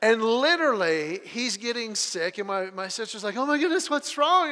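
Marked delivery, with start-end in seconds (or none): 3.4-4.5 mildly alarmed retold question, raised pitch; alarmed sister. Sister asks about sick child, mock alarm. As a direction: voice a worried sister, higher.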